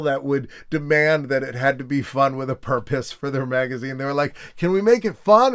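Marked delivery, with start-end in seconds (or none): 4.6-5.5 mock eager ask. Founders voiced.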